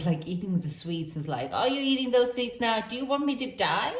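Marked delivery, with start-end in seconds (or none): 1.5-3.9 high-pitched mock Indian parent; playful guilt-tripping in an Indian accent. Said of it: "why did I even do this accent".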